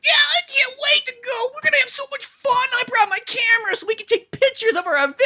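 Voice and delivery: very high, squealing cartoon child; hammed-up kid gushing at top pitch; excited kid. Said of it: "they got the kid guy".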